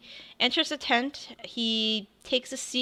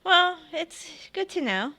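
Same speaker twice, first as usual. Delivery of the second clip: NPC voice; 10 semitones higher than usual. Acted. Tiefling NPC replies.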